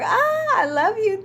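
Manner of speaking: high, loud burst of delight; squealing with real joy; excited gush. Real, not acted. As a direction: gush over a new subscriber. Thanking a viewer for subscribing.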